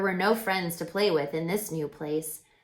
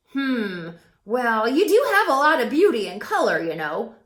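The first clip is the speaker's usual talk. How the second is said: crow Woof voice; 3 semitones higher, 5 dB louder than usual. A narrator's reading. Crow introduces himself to Jazz, loud and cheerful. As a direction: play the chatty friendly crow, loud and bright.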